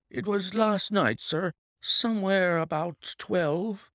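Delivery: high, agitated servant reporting; distressed butler. Acted. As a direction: report the shooting in alarm. Butler answers.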